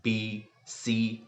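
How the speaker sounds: ए, बी, सी said very slowly, each letter drawn out; slow demo letters. Shown as a demonstration. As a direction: spell out ए, बी, सी one by one, very slowly. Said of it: "तो आपने देखा मेरी टंग और lips का". (ए, बी, सी को बहुत धीरे, अलग-अलग बोलना)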